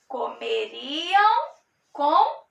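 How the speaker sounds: Comeriam said slowly with the final m marked; stressing the ending. Shown as a demonstration. Teacher stressing the final M of Comeriam for students. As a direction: lean on the end of Comeriam. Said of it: "M no final".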